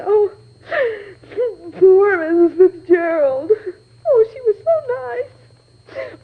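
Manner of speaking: crying, high and slow; sobbing over a kind woman's death; tearful crying. Acted. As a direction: choke the words out through tears. Distraught witness mourning murdered employer.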